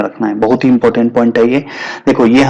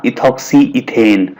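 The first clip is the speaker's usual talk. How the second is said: slow, drawn-out term; slow naming; much slower than usual. Shown as a demonstration. Teacher giving students the IUPAC name, slowly. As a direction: stretch out each part of the name.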